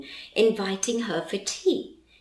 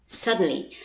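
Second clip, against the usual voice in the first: plain reading voice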